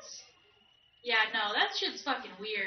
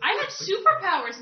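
excited boast; 7 semitones higher, 7 dB louder than usual. Pretend. Brash kid voicing game character.

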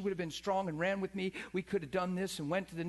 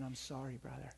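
hushed, mock-consoling pat on the back; soft, syrupy pity for a brother; a touch of fake comfort; mock sympathy; 7 semitones lower, 12 dB quieter than usual. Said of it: "patting each other and saying".

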